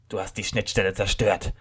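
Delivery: usual speaking voice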